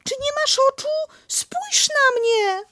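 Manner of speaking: desperate squeak; Piglet pleading. Acted.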